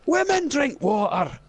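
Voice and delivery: mock him into a whiskey tour; Scottish teasing.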